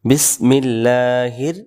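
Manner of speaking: বিসমিল্লাহির said slowly with a rising end; careful recitation. Shown as a demonstration. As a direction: sound out বিসমিল্লাহির deliberately. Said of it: "এটা হবে রহমানির".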